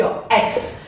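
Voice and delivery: soft, careful "X" said as eks; pronouncing X. Shown as a demonstration. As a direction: say "X" quietly as English "eks". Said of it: "a pronúncia é essa aqui".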